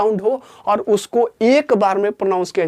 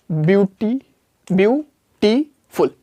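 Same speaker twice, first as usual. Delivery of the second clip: Beautiful stretched out slowly, bi-u-ti-ful; slowed syllable by syllable; much slower than usual. Shown as a demonstration. Teacher to class, beautiful slowly split into syllables. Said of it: "इस तरीके से pronounce कर रहा है".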